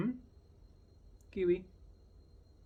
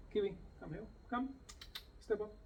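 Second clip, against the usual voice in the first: high, soft come here; lifted, gentle command; high coaxing call; 7 semitones higher, 9 dB quieter, much faster than usual. Shown as a demonstration. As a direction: coax him onto your hand. Calling parrot to step up.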